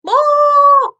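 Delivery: sharp, high, loud burst like a startled lizard; skink threat noise. Shown as a demonstration. Showing the kids how the skink scares predators: loud high threat burst. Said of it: "Can you guys do that?".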